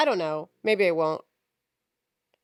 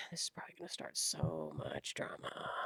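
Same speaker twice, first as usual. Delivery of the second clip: whispered aside; 14 semitones lower, 16 dB quieter than usual. Real, not acted. Whispered aside after insulting listeners.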